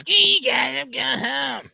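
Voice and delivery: high cartoon kid voice; Cartman voice. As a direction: squeak it like a spoiled cartoon boy. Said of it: "There you go. See, that's better".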